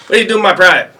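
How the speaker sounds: high, loud protective bark; drill sergeant voice. Retold or imitated. Drill sergeant confronting another sergeant, high loud bark. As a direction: stand up for your private loudly.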